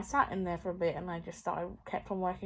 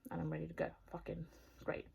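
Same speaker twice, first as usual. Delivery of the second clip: whispering; 3 semitones lower, 11 dB quieter, faster than usual. Real, not acted. Unvoiced sarcasm.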